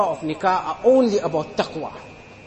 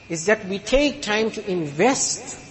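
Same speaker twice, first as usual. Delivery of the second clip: ordinary talk, nothing marked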